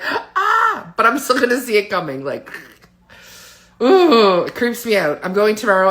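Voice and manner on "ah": mock cry. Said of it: "I can be like".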